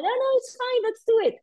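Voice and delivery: high, breezy insistence; squeaky mock guide confidence; insistent guide. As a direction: imitate the guide overruling them.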